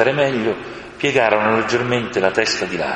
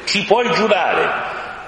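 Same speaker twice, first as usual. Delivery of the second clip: high, slow, cheeky crow's question; 11 semitones higher, much slower than usual. A narrator's reading. An impertinent crow teases Aslan, high and cheeky. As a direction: needle the lion like an impertinent bird. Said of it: "una cornacchia impertinente aggiunse".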